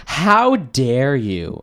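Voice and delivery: slow, loud how dare you; mock outrage. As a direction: ham up the guard's offended gasp. Guard's outrage acted out, mocking.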